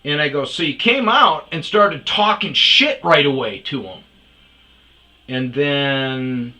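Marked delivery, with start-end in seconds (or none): 0.4-4.0 loud, higher scolding yell. Retold or imitated. Narrator scolding the old guy on the patio, loud. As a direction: tear into him for starting it. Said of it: "It was more yelling".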